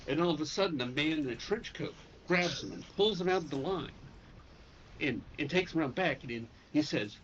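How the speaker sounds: ordinary talk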